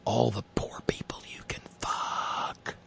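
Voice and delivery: sly conspiratorial whisper. Retold or imitated. Trump's mock message to Republicans, sly whisper. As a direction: whisper it like a dirty secret. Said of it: "It's to remind them".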